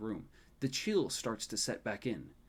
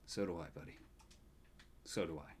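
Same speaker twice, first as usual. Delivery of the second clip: a little sadder; 6 semitones lower, 10 dB quieter than usual. A narrator's reading. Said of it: "His voice a little sadder now".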